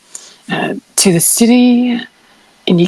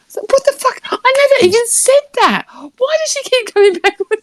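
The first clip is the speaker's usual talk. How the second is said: exasperated disbelief; 12 semitones higher than usual. Real, not acted.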